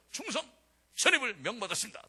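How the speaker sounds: crisp shouted military report; military salute shout. Retold or imitated. New officer reporting to his commander, barked salute. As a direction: snap to attention and report.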